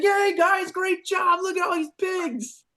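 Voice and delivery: very high, cheering mock praise; imitated delighted commander. Commander greets the bloody pig hunters, delighted. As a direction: hoot it like an oblivious, thrilled commander.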